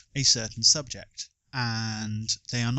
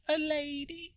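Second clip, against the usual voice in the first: much higher, thin quoted line; high film quote voice; 4 dB quieter than usual. Retold or imitated. Quizmaster quoting a film line in a high voice. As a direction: pitch it way up for the quote.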